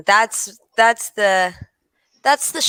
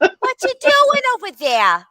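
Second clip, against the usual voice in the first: a touch of a prying neighbor, higher; nosy neighbor; 9 semitones higher than usual. Retold or imitated. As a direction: call over the fence like a nosy neighbor. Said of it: "equivalent of Missus Kravitz next door".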